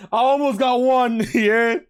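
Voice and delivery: laughing. Real, not acted. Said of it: "community guideline strike".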